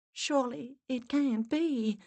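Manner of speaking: high, slow, wondering voice; disbelief. A narrator's reading. Larissa doubting what she heard, incredulous. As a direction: draw it out in hushed disbelief.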